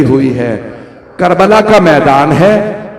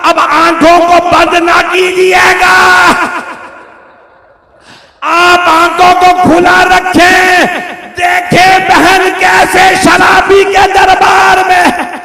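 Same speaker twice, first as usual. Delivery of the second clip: anguished plea; 9 semitones higher, slower than usual. Acted. Zainab calling to her brother before the court, anguished slow cry.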